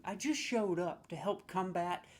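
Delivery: normal talking voice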